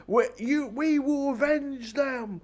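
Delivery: high, slow mock Magneto vow; Magneto quote. Reviewer quoting Magneto's vow, mock grandiose. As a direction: ham up the villain's grand threat. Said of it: "when Magneto gets that train and says".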